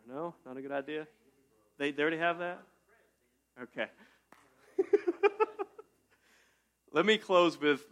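usual speaking voice

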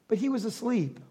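dropped to a soft near-whisper; quiet deadpan reveal; hushed aside. Shown as a demonstration. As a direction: let it fall away like an afterthought. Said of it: "I read it like that".

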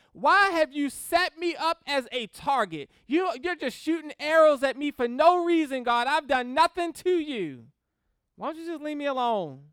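loud accusing whine; aggrieved protest. Acted. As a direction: accuse God as if he were a bully. Job accusing God as adversary.

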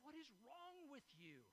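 dad's exasperated question. Retold or imitated. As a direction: drag it out like a baffled father. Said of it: "my dad would look at us and go".

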